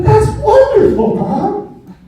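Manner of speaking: put-on high, loud, gushing little-kid wonder; mock-delighted child. Child answering mother's creepy tale, mock-delighted.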